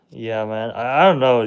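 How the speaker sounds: ordinary talk, nothing marked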